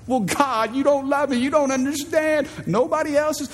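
high whiny protest; sulky person arguing with God; whiny complaint. Acted. Person arguing with God about a no, whiny protest.

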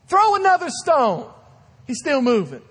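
loud, high crowd yell; yelling from the stoning crowd; shouted order. Acted. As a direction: bark it like a mob egging on. Preacher acts out stoning crowd.

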